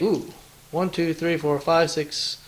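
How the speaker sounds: usual speaking voice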